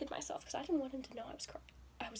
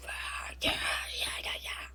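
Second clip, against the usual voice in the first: mock passionate yeah; 5 dB louder than usual. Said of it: "that sort of passion".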